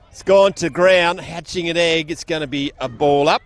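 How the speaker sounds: brisk live football call from the box; play-by-play commentary. Acted. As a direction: call the game as it unfolds, rising with the play.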